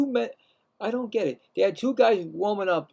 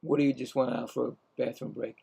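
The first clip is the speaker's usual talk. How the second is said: ordinary talk, nothing marked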